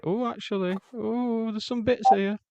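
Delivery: a squeaky sudden discovery; high surprised voice. Retold or imitated. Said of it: "and then be like".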